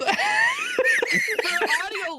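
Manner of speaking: high, loud, slow words through laughter; real giggles. Real, not acted. Co-host addressing audio listeners, loud and helplessly giggling. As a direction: struggle to announce it while laughing. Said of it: "Get out the giggles, buddy".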